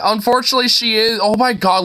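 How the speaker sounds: ordinary talk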